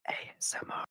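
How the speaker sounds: soft breathy announcer whisper; ASMR whisper. Pretend. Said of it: "listening to Apple ASMR".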